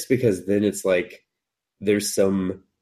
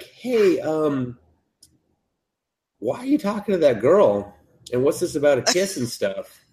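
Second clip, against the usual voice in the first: much higher mock schoolgirl; high girly voice; 6 semitones higher than usual. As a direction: play the puzzled crush, sweetly. Girl voiced in recap.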